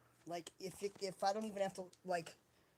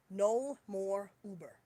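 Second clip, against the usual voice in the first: slow, louder, each word weighted; firm retold resolve; 5 dB louder, slower than usual. Retelling the vow made when buying the bicycle, firm. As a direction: put your foot down word by word.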